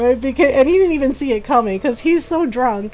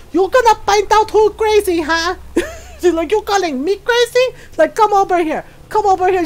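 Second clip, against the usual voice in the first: mock-furious, taunting him closer; angry mom threat; 7 semitones higher than usual.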